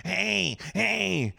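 high, drawn-out heckling call; mock yelling. Old driver heckling from the next car, mock yell. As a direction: imitate an angry driver hollering, without full volume. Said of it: "he's yelling over my girl's side".

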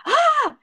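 short high yelp of fright; mock panicked shriek; startled shriek. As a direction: squeal like a car swerved at you. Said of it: "and I went".

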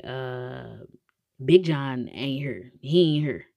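low, slow dismissive guard voice; lightly voiced guard, low and slow; security guard. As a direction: drag it out like a bored guard. Guard voiced in retold scene.

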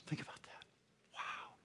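breathy hushed awe; whisper. Real, not acted. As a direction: breathe it like a secret. Hushed after reading quote.

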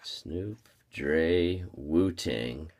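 softly repeating the list under breath; barely audible, half to himself; quiet murmured echo. Real, not acted. Friend echoes the list back.

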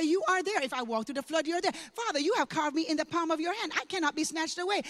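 rising scripture declaration; building from steady to strong prayer; declared prayer. Acted. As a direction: proclaim it as your own prayer of faith.

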